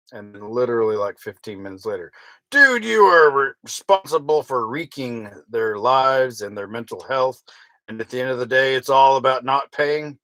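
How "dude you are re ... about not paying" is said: loud read of a shouty comment; reading all caps. Retold or imitated. Reading a nasty comment aloud, mock shouting. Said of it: "in all caps".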